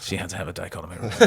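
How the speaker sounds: low, hushed mock bad news; solemn doctor. Mock doctor breaking news to a husband, solemnly. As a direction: drop soft and low, gravely apologetic.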